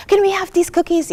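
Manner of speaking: put-on high, eager kid asking; child's voice. Child to mom in the supermarket, high pleading. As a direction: play the little kid begging.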